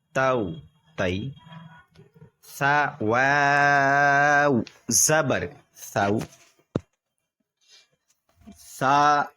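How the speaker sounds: slow chant with long-held letter names; recitation drill. Shown as a demonstration. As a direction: lead students syllable by syllable, pausing for repeats. Leads repeat-after-me recitation drill.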